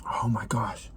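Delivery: awed whisper. Real, not acted. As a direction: breathe it out in awe. Reacting to food.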